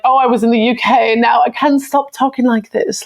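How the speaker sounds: high, affected mock British accent; put-on British accent. Mocking people back from the UK, in an affected British accent. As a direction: imitate someone showing off a British accent. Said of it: "one of those people that's like".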